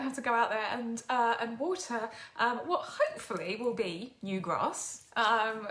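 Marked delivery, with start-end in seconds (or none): none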